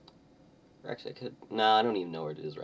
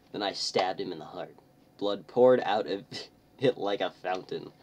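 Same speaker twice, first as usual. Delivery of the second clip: usual speaking voice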